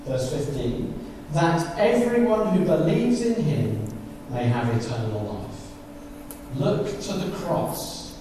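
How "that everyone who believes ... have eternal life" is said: slow, fading reverent reading; scripture reading. Acted. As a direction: let the verse die away softly. Reads verse fifteen.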